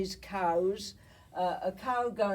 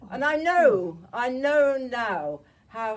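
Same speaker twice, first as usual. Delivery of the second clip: emotional emphasis; 4 semitones higher, 10 dB louder than usual. Real, not acted. Moved by sister's reply.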